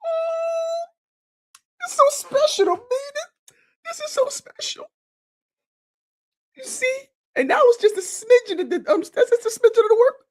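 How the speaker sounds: choked up, barely holding it together; real tears in a high voice; tearful. Real, not acted. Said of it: "I think I'm getting emotional".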